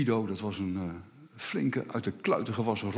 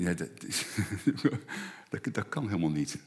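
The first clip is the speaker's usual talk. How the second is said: mild disbelief; 5 semitones lower, 6 dB quieter than usual. Retold or imitated. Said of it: "dan denk je".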